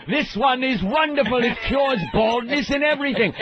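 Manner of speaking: high-pitched huckster pitch; mock medicine-show salesman; old-time elixir salesman. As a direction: hawk a miracle cure from a wagon. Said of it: "like those guys in the old".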